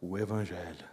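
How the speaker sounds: low, very soft, tender voice; sweet voice. Retold or imitated. Preacher retells the Spirit urging him to preach, sweet and hushed. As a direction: let it sink to a gentle hush. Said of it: "aquela mesma doce voz disse".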